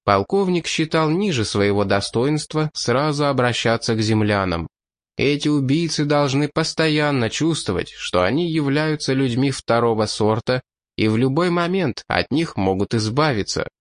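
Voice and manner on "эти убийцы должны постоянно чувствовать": plain reading voice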